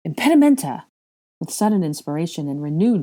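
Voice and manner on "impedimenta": loud, high incantation; urgent shouted casting; spell cry. Acted. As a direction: fire off a spell suddenly.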